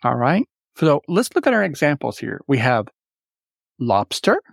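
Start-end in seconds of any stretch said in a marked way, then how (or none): 3.8-4.4 "lobster" said alone, weight on its first syllable, lob; first-syllable stress. Shown as a demonstration. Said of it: "Notice the accent on lob".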